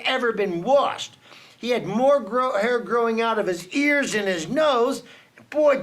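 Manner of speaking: usual speaking voice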